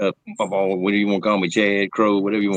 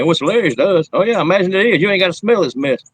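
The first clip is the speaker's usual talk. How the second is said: high, hammed-up exasperated retelling; mock indignant quarrel; 10 semitones higher, 5 dB louder than usual. Griping that they needn't smell this mess, mock exasperation. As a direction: argue back in a loud, high mock whine.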